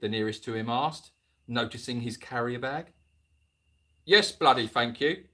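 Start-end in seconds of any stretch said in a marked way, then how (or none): 4.0-5.2 lightly voiced Michael Caine, a touch louder. Retired man thanks the boys in Michael Caine's voice, lightly done. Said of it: "Michael Caine".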